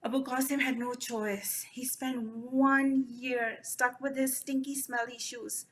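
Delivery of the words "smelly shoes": plain reading voice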